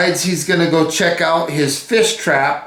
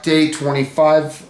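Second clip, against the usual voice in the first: usual speaking voice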